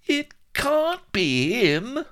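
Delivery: very high, slow, squeaky character voice; high-voiced little sidekick in dismay; muttering Vladimir. A narrator's reading. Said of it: "muttered Vladimir".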